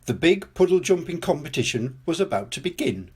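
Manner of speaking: ordinary delivery, nothing marked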